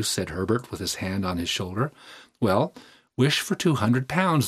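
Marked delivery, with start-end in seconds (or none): 2.4-4.5 lively, higher-pitched proposal; breezy suggestion. A narrator's reading. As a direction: toss out the idea brightly. Son casually suggests a wish.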